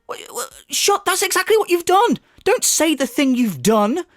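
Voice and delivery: sputter, then leap into a high-pitched rant; incredulous, way up high; exasperated outburst. Real, not acted. Critic calls out the streamer's false denial, exasperated.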